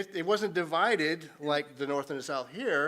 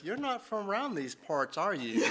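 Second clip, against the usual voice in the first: put-on Southern accent; 3 semitones higher than usual. Southerners questioning an outsider, imitated Southern accent. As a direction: play a Southerner sizing up a newcomer. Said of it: "They follow up with".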